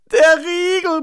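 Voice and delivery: put-on high, loud little-boy yell; shrieked complaint from a stuck child; bellowing child. A narrator's reading. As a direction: yell it through a locked door. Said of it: "brüllt Florian".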